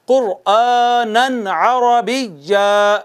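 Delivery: कुरआनन अरबिय्या slowly, नून sounded before अरबिय्या; slow recitation. Shown as a demonstration. Teacher modelling the correct reading. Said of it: "नहीं कहना".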